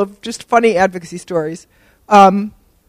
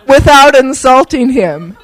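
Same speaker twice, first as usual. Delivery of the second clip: ordinary delivery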